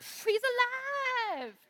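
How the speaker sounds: slow, high, drawn-out proclamation; mock miracle announcement.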